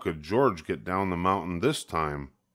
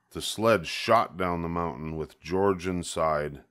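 ordinary delivery, nothing marked

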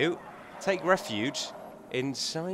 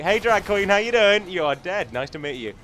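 loud, sing-song mock hello to the enemy; mock cheery greeting; 5 semitones higher, 8 dB louder than usual. Player jokingly greets a dying enemy, loud mock cheer. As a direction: greet the drag like a chummy host.

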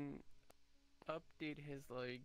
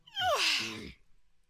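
loud throat clear; 15 dB louder than usual. Real, not acted.

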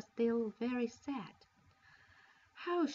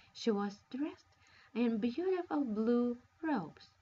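ordinary delivery

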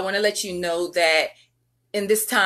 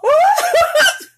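high, loud squealing laugh; high burst of laughter; 9 dB louder than usual. Real, not acted. Embarrassed after a slip.

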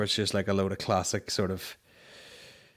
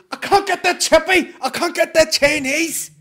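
mimicking the man in the clip; 11 dB louder than usual. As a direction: squeal it out like the fed-up fella in the video. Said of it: "He goes".

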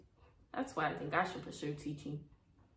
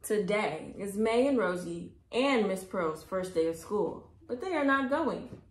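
plain reading voice

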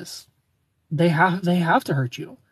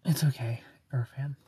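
whispered reassurance; soft whisper; 3 semitones lower, 6 dB quieter than usual. Real, not acted. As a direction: hush it gently to the viewer. Reassuring a viewer softly.

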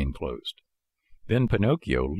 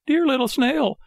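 very high puppet call; high-pitched Pinocchio calling from below; crying out; 4 dB louder than usual. A narrator's reading. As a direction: call up pleadingly in a small high voice. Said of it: "cried Pinocchio from the street".

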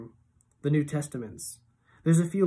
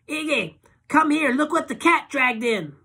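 high, loud tipsy woman calling out; brassy drunk woman greeting the door; high tipsy woman calling Iggy; Loretta's voice; 12 semitones higher, 7 dB louder than usual. A narrator's reading.

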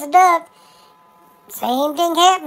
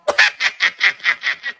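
silent wheezing laugh; 9 semitones lower than usual. Real, not acted. Laughs at own punchline.

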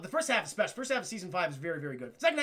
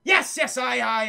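flustered dog; 3 semitones higher, 8 dB louder than usual. Acted. Dog flustered by Jack's shock.

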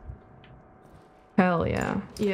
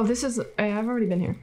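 normal talking voice

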